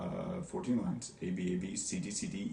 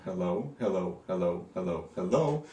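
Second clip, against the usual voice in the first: evenly repeated "hello", each stressed on the second syllable; iambic beat; 2 semitones lower than usual. Shown as a demonstration. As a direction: tick out "hello" as a steady da-DUM. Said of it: "that's four iambs".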